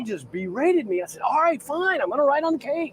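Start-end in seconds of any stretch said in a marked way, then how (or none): 1.2-2.9 higher, put-on grudging surrender; mock exasperated giving in. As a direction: throw up your hands, hammed up.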